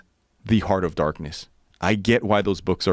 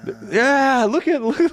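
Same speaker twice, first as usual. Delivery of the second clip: high, laughing babble; overwhelmed and giggling, pitched up; excited jabber; 3 dB louder than usual. Retold or imitated. Mimicking villagers crowding around, high and laughing.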